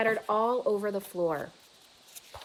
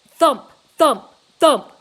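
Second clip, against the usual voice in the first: three loud booming thumps; chest thumps; 5 semitones higher, 16 dB louder, slower than usual. A narrator's reading. Angry gorilla beats his chest, loud thumps. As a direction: pound out each thump like a drum.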